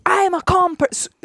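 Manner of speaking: high, mock-indignant man insisting he's calm; mocking the neighbor. Neighbor confronting her in the hall, mock-indignant. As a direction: imitate a riled man claiming calm. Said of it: "he's like".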